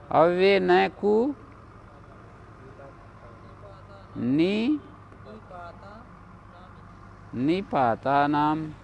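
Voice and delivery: slowly, word by word, for the class to note down; slow dictation. Shown as a demonstration. Teacher dictating the rule slowly to students. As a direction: spell it out slowly so students can write it.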